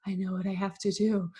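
quietly solemn declaration, falling at the end; mock dramatic. Telling husband of her new calling, dramatic resolve. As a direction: announce it like a fateful revelation. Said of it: "it sounded so dramatic".

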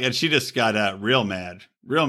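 ordinary talk, nothing marked

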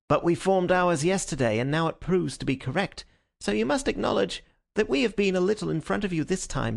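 a hint of a gloating police inspector; triumphant Lestrade. A narrator's reading. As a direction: rub the detective's nose in it, lightly voiced. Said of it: "his manner grossly triumphant".

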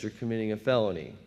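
ordinary delivery

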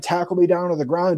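ordinary delivery

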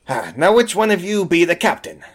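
rolling pirate talk; pirate diplomat. Acted. Pirate diplomat seeks out the captain, pirate voice. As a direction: ask it in old sea-dog speak. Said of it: "And she says".

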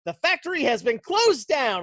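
retold shout, high and loud; calling out an announcement. Man runs out announcing the factory's closing, calling out. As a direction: call it out like breaking news.

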